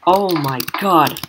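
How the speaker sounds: ordinary talk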